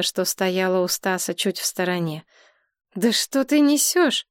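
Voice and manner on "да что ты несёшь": higher-pitched indignant cut-in; interrupting objection. A narrator's reading. Woman interrupts her drunk friend, higher and indignant. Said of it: "перебила её Галина".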